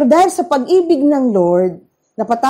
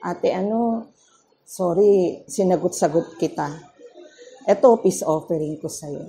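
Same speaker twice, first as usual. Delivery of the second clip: much softer, lower, humble sorry; soft sheepish apology; 7 semitones lower, 7 dB quieter than usual. Retold or imitated. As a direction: apologize meekly to your older sister-in-law. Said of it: "sabi ko". (mahina at mababang paghingi ng tawad kay Ate)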